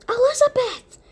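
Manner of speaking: high, loud yell; yelling a name. Acted. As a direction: shout her name from afar. Yelling for her sister.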